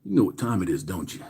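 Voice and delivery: normal talking voice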